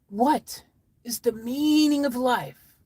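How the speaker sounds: slow, drawn-out question, high on MEANING; story character asking. Acted. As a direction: ask it slowly and ponderously, falling at the end. Story character asks solemn question.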